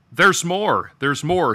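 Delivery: eager shout over applause; excited shout. A narrator's reading. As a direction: call out excitedly over a noisy crowd. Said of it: "shouted Arthur excitedly over the din".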